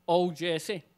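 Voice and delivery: ordinary delivery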